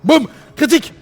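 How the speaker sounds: high, loud call; excited shout. Real, not acted. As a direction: cheer the critical hit. Critical hit reaction.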